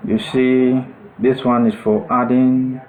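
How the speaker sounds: normal talking voice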